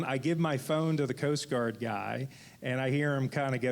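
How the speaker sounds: ordinary talk, nothing marked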